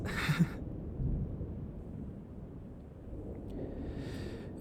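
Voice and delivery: hushed airy chuckle; soft laugh. Acted. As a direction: chuckle under your breath. Laughs about the professor.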